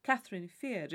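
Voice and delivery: ordinary delivery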